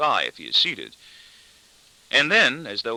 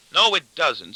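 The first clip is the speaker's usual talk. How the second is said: plain reading voice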